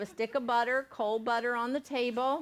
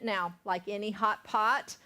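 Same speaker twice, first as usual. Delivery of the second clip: ordinary delivery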